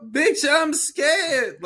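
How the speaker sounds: high, loud, drawn-out cry; mock scared wail. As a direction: wail it slowly in exaggerated fright. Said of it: "and they're crying".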